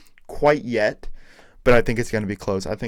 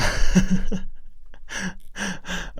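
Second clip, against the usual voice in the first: breathy laugh; 9 semitones higher than usual. Real, not acted. Laughs before wrapping up.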